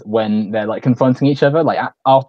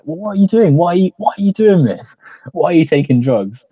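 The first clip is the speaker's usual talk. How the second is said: mock scolding; 8 semitones higher, 4 dB louder than usual. Confronting Denny about drugs.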